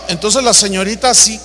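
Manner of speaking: usual speaking voice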